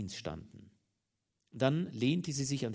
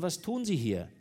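pressing, higher and louder; repeated louder; 6 semitones higher, 4 dB louder than usual. A narrator's reading. Commissioner repeats his question to the wife, louder. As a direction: demand it a second time. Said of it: "Der Beamte wiederholte".

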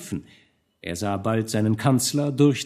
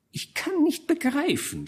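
high, hoarse and reedy scheming chancellor; a sly courtier, high and rasping; oily chancellor wheedling the hesitant duke in a high, hoarse voice; hoarse, thin voice. A narrator's reading. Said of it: "sprach er mit heiserer, feiner Stimme".

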